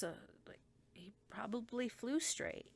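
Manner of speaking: hushed unvoiced whisper. Real, not acted. Telling viewers about his honesty, whispered. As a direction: whisper it like a secret. Confiding aside.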